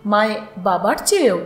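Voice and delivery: usual speaking voice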